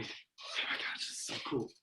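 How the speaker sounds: very soft, voiceless whisper; excited whisper. Retold or imitated. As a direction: re-enact a thrilled whisper from hiding. Hiding boys watch the car approach.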